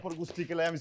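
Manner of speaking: ordinary talk, nothing marked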